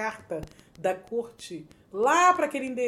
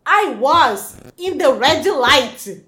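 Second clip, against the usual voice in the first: put-on slow, loud English with thick Brazilian accent; heavy Brazilian accent; 3 semitones higher, 12 dB louder, much slower than usual. New immigrant tells police the accident, heavy Brazilian-accented English. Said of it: "Aí vem o meu inglês".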